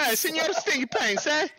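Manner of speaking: high, hammed-up Spanish accent. Co-host copies the nickname, mock Spanish accent. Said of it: "in a Spanish accent".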